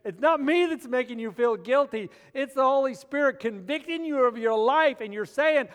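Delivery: normal talking voice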